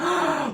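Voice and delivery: sharp mock gasp of shock. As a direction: gasp as if scandalized, imitating her. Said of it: "she's shocked and".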